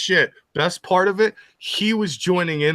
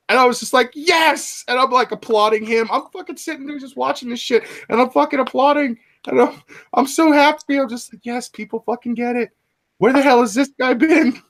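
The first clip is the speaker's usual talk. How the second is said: high, giddy, cheering delight; excited; 11 semitones higher, 3 dB louder than usual. Real, not acted. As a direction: gush with happy excitement. Excitedly recounting applauding a streamer.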